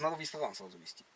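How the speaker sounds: usual speaking voice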